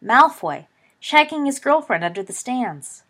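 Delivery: loud shout through cupped hands. A narrator's reading. Slytherin girl shouting the gossip to the crowd from the railing, loud. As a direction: call it out across the stadium. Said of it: "and shouted".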